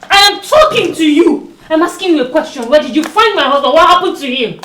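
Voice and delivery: loud, insistent shouted question; demanding shout. Acted. Wife shouts at sister-in-law, demanding answers about her husband.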